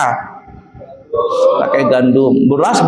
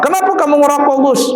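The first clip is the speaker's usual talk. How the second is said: high, indignant father's rebuke; a father catching his son smoking, high and indignant; scolding father; 10 semitones higher, faster than usual. Retold or imitated.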